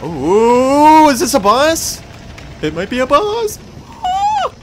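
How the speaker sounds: high, loud, thrilled; excited. Real, not acted. Player spotting a possible boss, excited high voice. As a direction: squeal with nervous excitement.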